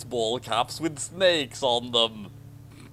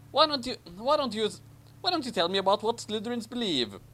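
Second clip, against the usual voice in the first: higher, fast, prim schoolboy; Harry voice; 7 semitones higher, faster than usual. Acted. Harry asking Ron about Slytherins, high and quick. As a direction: chirp the question like an innocent boy.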